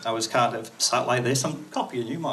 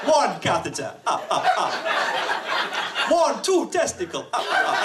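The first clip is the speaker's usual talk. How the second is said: high, hammed-up counting vampire with staccato laugh; bad Italian accent turned cartoon Count; Count off Sesame Street; 10 semitones higher than usual. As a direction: count it out, then cackle theatrically. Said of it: "sounds like the Count off Sesame Street".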